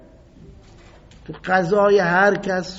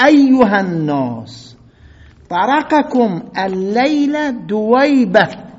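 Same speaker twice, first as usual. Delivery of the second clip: slow, loud, drawn-out proclamation; mocking public denunciation, loud and slow; scornful caliph; 4 semitones higher, 6 dB louder, slower than usual. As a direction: announce the insult slowly to the crowd. Uthman insults Ibn Masud publicly.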